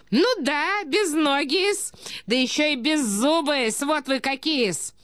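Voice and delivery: high, drawn-out taunts; spiteful taunting. A narrator's reading. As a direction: pile on insults with relish. Lady hurling insults at prince. (язвительно, высоким голосом)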